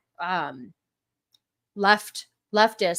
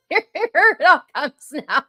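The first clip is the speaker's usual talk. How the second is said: loud high laughter breaking through words; helpless laughing fit; laughing hard; 9 semitones higher, 8 dB louder than usual. Real, not acted. Cracking up at clip.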